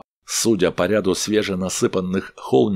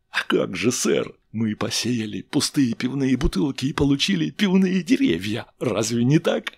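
higher, eager tipsy chemist; tipsy chemist explaining eagerly; tipsy crewman; 8 semitones higher than usual. A narrator's reading.

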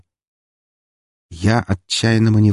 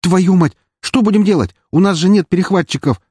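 high, loud panicked outburst; panicking crew member, voice raised; 11 semitones higher, 5 dB louder than usual. Acted. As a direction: cry out in alarm, pitch jumping up. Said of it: "запаниковал Трой".

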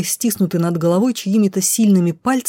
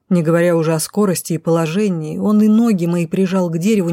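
ordinary delivery, nothing marked

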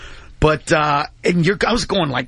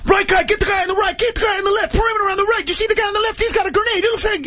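much higher, urgent shouted commands; barked combat orders; 14 semitones higher, faster than usual. Retold or imitated. Marine in a Humvee calling out approaching men, urgent shouted orders. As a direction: call targets like a firefight.

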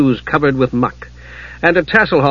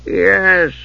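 icy, higher-pitched reply; frozen tones; 6 semitones higher than usual. A narrator's reading. Monk recognising an unwelcome kender, in frozen tones. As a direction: answer with frosty disdain. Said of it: "said Bertram in frozen tones".

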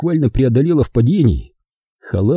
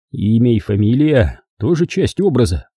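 usual speaking voice

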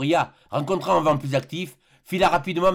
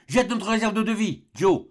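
high-pitched exclaimed order; pressing narrated exclamation; urgent cry; 6 semitones higher than usual. A narrator's reading. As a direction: call out the order urgently, without shouting. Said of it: "s'écria le docteur".

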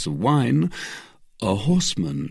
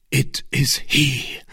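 slow, higher, intense exclamation; angry cry; 6 semitones higher, slower than usual. Acted. D'Artagnan recognising the man, angry cry. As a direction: cry out, each word weighted with anger. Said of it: "pale with anger".

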